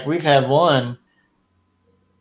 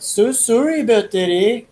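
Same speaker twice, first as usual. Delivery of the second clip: high Canadian accent apology; a mocking Canadian sorry; 9 semitones higher than usual. Shown as a demonstration. Comparing accents up there, high Canadian accent. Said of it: "up there it's like".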